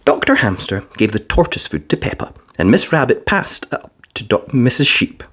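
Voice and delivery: ordinary delivery, nothing marked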